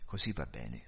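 low, soft self-talk; talking to herself. A narrator's reading. Wife reassuring herself on the way home, low and soft. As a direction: murmur it privately, well down in pitch. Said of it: "si disse".

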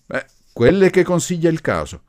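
loud, high comeback; heated retort. A narrator's reading. Professor justifying his rough methods, heated retort. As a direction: fire back forcefully. Professor answers back in the quarrel.

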